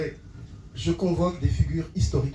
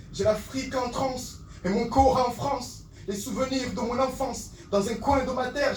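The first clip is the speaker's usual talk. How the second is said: impassioned slam refrain; 10 semitones higher, 4 dB quieter than usual. Acted. Poet torn between Africa and France, impassioned slam. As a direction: ring the verse out with fervour.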